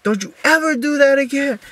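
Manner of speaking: high, loud furious warning; angry warning. Retold or imitated. Grabbing the pin back from the roommate, furious and high. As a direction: threaten him, furious. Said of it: "I'm like".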